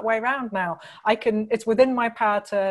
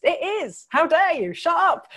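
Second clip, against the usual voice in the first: mock outrage; 7 semitones higher than usual. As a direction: play at being scandalised, half joking. Mock outrage defending the text.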